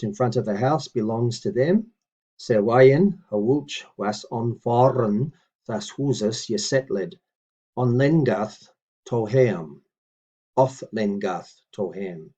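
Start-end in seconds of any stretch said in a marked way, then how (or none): 2.4-12.3 slow, deliberate word-by-word recitation; measured teaching read; careful Old English reading. Shown as a demonstration. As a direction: read each word out for the learner. Reads Old English model sentence.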